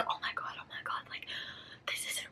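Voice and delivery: very soft, voiceless whisper; hushed retold alarm, barely audible; panicked whisper. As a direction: whisper secretly to a friend on the phone. Secret alarm to friend on phone.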